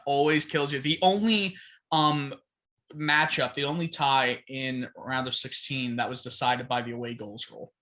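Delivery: ordinary delivery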